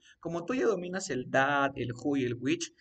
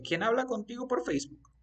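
higher, flowing acted question; natural question; 9 semitones higher than usual. Shown as a demonstration. Teacher asks the student who talks on Facebook, higher natural question. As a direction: ask it in one run, pitched up.

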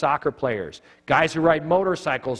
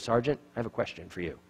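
quiet menace; 3 semitones lower, 8 dB quieter, faster than usual. Retold or imitated. Charlie squares up nose to nose.

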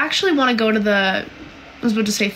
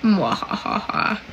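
slow, drawn-out mock evil laugh; villain cackle; much slower than usual. Teasing fans about leaking songs, mock evil laugh. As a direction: cackle like a scheming cartoon villain.

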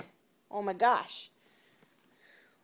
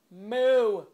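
louder, insistent moo; playful cow imitation, louder; 6 dB louder than usual. Repeats moo to get a response.

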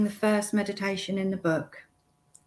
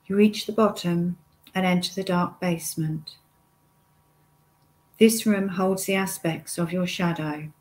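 guided meditation. Acted. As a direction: lead the listener step by step, unhurried, pausing often.

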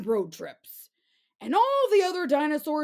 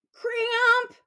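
pained yelp; 10 semitones higher than usual. Acted. Swimming dinosaur gets a cramp.

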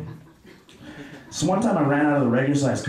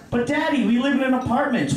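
put-on whiny child; 6 semitones higher than usual. Kid answering the dad.

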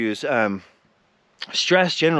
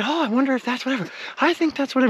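musing speculation; 10 semitones higher, faster than usual. Retold or imitated. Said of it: "you would kinda speculate".

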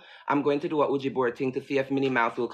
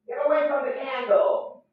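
louder repeat; 11 semitones higher than usual. Real, not acted.

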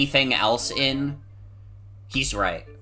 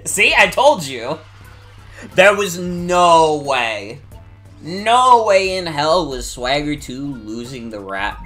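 excited; 2 semitones higher, 6 dB louder, slower than usual. Real, not acted. Gloating, vindicated after rap battle win.

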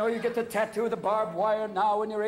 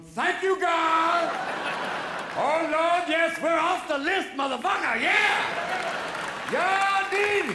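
high, loud shouting celebration; men in line shouting with joy at being spared; overjoyed relief; 10 semitones higher, 6 dB louder, much slower than usual. Retold or imitated. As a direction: cheer like you just escaped the list.

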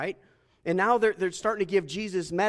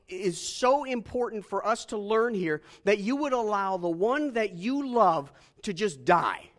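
ordinary talk

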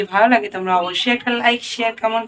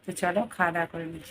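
much softer and quicker, hushed; quick hushed aside so nobody wakes; softly murmured to the camera; speaking softly; 3 semitones lower, 12 dB quieter, faster than usual. Real, not acted. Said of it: "ওর জন্য আস্তে আস্তে কথা বলছি".

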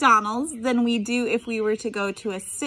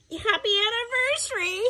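croaked; 8 semitones higher than usual. A narrator's reading. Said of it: "he croaked".

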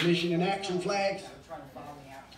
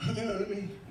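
ordinary delivery

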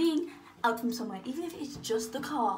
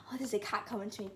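normal talking voice

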